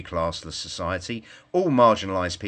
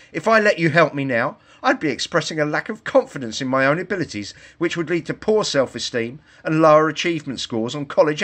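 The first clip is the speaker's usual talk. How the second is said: higher, louder, keep it heated; loud indignant rant; 6 semitones higher, 5 dB louder than usual. A narrator's reading. Girl refusing the woodchopper's help, heated. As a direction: rant at him in outrage.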